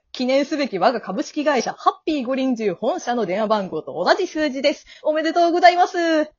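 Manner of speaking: company rep's upbeat patter; cheerful afterlife salesperson; breezy grim reaper guide. Acted. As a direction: reel it off like a promotion, ending high.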